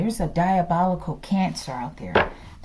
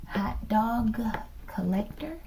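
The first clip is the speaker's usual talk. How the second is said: ordinary talk, nothing marked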